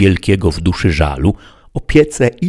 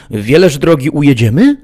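much higher, louder, quick squire voice; Sancho asking eagerly; 9 semitones higher, 6 dB louder than usual. Acted. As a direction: press your master with a naive question. Sancho asks master.

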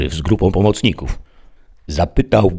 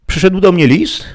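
high, louder female character voice; woman clerk; 6 dB louder than usual. A narrator's reading. Woman at the desk tells the deputy, high voice. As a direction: lift the voice right up.

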